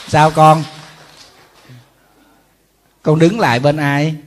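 loud, exasperated scolding of a careless son; a monk loudly scolding an irresponsible son in jest; loud mock scolding. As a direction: raise the voice and tell the boy off, half joking. Scolding the sons, half joking.

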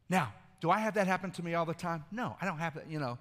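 ordinary talk

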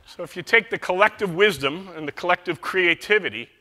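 ordinary talk, nothing marked

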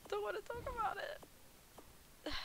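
high, slow, soft mock cry; tearful whine. Gamer shaken by the cave scare, tearful whine. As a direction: whimper it slowly like you might cry.

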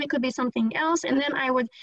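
ordinary talk

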